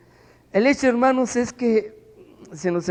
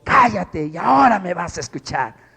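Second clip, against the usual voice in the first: retold command; 4 dB louder than usual. Pastor confronts man.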